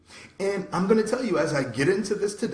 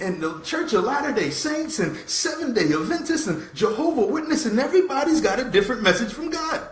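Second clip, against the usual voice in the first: raised, higher preaching voice, louder on the list of churches; voice going up; 8 semitones higher, 3 dB louder than usual. Real, not acted. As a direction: build passion through the list of denominations. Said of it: "So when my voice goes up".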